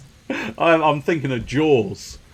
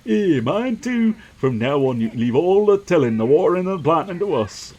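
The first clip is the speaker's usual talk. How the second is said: higher-pitched, keen farmer voice; eager farmer; 6 semitones higher than usual. Acted. Farmer hands over the work to the builder, eager high voice. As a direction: pledge help as an eager farmhand.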